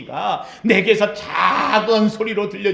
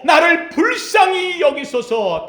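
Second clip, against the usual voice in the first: raised, slowed cry for mercy; beggar's cry; 7 semitones higher, slower than usual. Acted. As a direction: Bartimaeus shouting to Jesus. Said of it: "바디메오".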